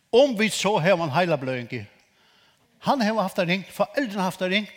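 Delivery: high, loud and urgent; fervent preaching. Acted. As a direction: drive the point home. Sermon climax.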